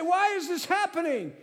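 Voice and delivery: high, loud cry of why; upset questioning. Acted. Preacher acting out people questioning God, upset questioning.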